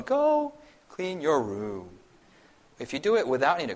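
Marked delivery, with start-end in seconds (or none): 0.0-1.9 slow flat threatening order; monotone. Shown as a demonstration. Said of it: "it's, it's monotone".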